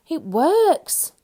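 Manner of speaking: high, loud delighted exclamation; amazed wonder. A narrator's reading. Mister Jelly amazed the counting trick works, high and loud. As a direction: marvel out loud. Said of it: "in wonderment".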